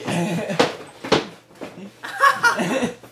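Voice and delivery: big high burst of laughter; loud laugh. Real, not acted.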